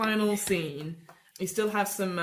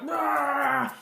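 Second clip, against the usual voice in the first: battle cry; 6 semitones higher than usual. Retold or imitated. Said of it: "you're like".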